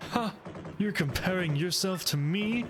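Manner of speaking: slow, smug edgy hero voice; brooding rival sneering down; Shadow impression. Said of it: "make Dirk sound like Shadow".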